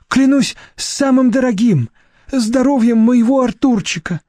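high pleading oath; trembling voice. A narrator's reading. Deputy director swears to Nadezhda on his dog, frightened. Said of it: "дрожащим голосом".

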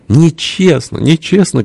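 high-pitched plaintive protest; crying out in protest. A narrator's reading. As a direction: cry foul in a high little voice. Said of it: "крикнул Фёдор Кузьмич". (высокий обиженный выкрик)

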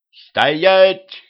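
high, loud cry to halt; shouted order. A narrator's reading. Narrator tries to stop Дюдя rushing off, shouting. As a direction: shout to stop someone in their tracks. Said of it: "крикнул я".

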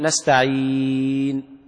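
نستعين with the ii held long and chanted, very slow; four-count madd. Shown as a demonstration. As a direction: draw out نستعين for four beats in a recitation chant. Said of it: "مد أربع حركات بالطريقة دي".

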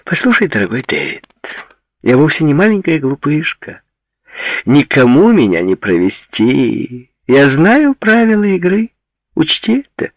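high, loud woman's character voice; indignant high-pitched retort; defiant young actress, indignant. A narrator's reading.

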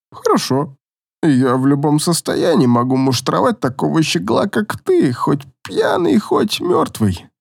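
a tipsy trainer bragging; drunk elf boasting. Acted.

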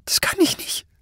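very high, strained, half-voiceless squeal; panicked refusal. A narrator's reading. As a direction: squeeze it out high and almost without voice. Terrified man refuses the demand. (panisch, fast tonlos gepresst)

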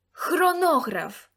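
loud, slow, high cry of Хронограф with a falling end; exclaimed. A narrator's reading. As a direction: call out Хронограф as the obvious answer. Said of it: "вигукнув Лукас".

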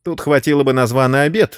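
ordinary delivery

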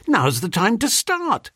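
high-pitched, eager prompt; urging. A narrator's reading. Narrator pressing Jeeves to start, urging. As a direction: urge him on, voice lifted. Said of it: "I urged".

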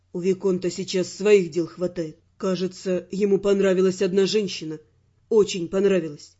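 low, measured male character voice; Raymond, self-assured man. Acted. Raymond telling the heroine she will confide in him, low and assured. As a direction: speak low and knowingly, unhurried.

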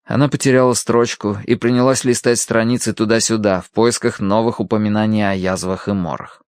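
ordinary delivery

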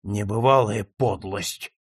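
low, slow, appalled remark; low indignation. A narrator's reading. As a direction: say it slowly under a cloud of disgust. First, stunned take before the shout.

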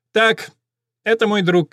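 high, lightly nasal reporter voice; a thin, reedy old reporter. A narrator's reading. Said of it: "тонкий гнусавый голос".

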